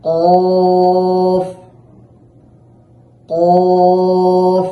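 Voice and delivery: loud sung Qaf, held from the back of the tongue; Arabic letter demo. Shown as a demonstration. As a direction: chant কফ heavy, lips relaxed. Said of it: "এই কফের উচ্চারণ হচ্ছে". (জোরে টেনে কফ উচ্চারণ)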